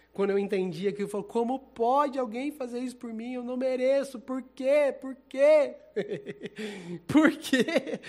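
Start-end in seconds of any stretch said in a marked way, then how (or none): 1.2-8.0 loud, high, pleading shout of why; retold cry to God. Crying out to God, why would he die for me, loud pleading shout. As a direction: cry out to God in disbelief at his love. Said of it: "Isso era o que eu gritava".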